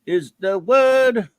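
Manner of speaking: mock preacher. Host mock-preaching to listeners, hammed-up sermon. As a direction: lean in like a pulpit preacher.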